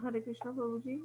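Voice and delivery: faint, far from the mic; weak, barely carrying voice; barely audible. Real, not acted. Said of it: "आपकी आवाज कम आ रही है".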